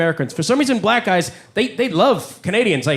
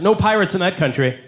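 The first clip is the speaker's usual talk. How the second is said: ordinary delivery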